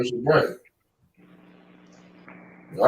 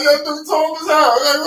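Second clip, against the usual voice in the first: amazed; 12 semitones higher, slower than usual. Retold or imitated. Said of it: "You like".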